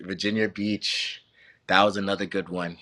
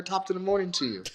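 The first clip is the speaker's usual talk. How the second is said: higher, quicker, chirpy neighbor greeting; cheery suburban neighbor; 8 semitones higher, 3 dB quieter, faster than usual. Retold or imitated. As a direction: toss off a sunny hello over the picket fence.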